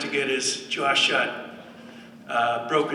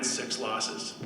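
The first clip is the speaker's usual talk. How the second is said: ordinary talk, nothing marked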